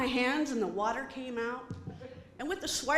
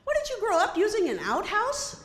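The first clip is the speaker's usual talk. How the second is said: mocking sneer; 8 semitones higher, 3 dB louder than usual. Lady mocks her.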